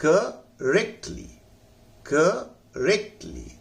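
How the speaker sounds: Correctly slow, with रेक stressed, क and ली light; stress on rek. Shown as a demonstration. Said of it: "रेक पर जोर लगाना है". (Correctly में रेक पर जोर)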